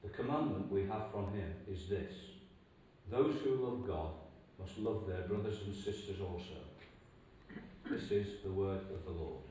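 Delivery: ordinary talk, nothing marked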